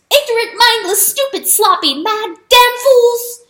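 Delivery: high, loud enraged mouse squeak; furious squeak. A narrator's reading. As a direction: spit it out in a tiny fury. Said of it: "he squeaked".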